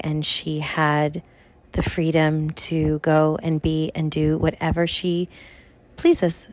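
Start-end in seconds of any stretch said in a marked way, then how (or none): none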